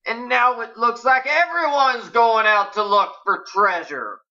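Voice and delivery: barely disguised irritation. A narrator's reading. Dave complains that word got out, barely hiding irritation. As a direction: press the grievance, keeping it civil. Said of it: "barely able to disguise his irritation".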